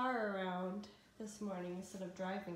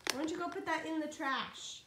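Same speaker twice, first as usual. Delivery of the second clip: higher coaxing mom voice; 6 semitones higher than usual. Real, not acted. Mother tells toddler to throw away.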